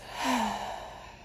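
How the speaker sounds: long, audible exhale falling away; clearing sigh. Shown as a demonstration. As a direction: sigh it out to let everything go. Demonstrates a clearing breath.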